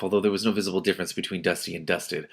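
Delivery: ordinary delivery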